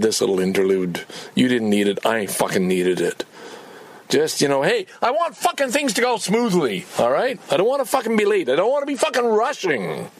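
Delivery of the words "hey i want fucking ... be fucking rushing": higher, heated rant; frustrated rant. Real, not acted. Vlogger ranting that he doesn't want to be rushed, heated. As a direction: let the irritation boil up. Ranting about wanting no rush.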